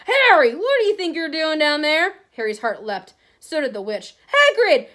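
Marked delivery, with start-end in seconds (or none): 0.1-2.2 loud, high-pitched bellow of alarm; calling out. Acted. Big man calls out to boy. 4.3-4.9 high, loud call of recognition; relieved cry. Acted. Boy spots his friend.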